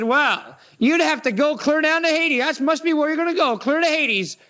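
a hammed-up scornful Pharisee; mocking Pharisees. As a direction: sneer down at him as the righteous ones.

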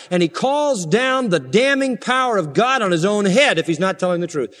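high-pitched, emphatic pulpit delivery; forceful preaching. Real, not acted. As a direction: drive each oath home from the pulpit.

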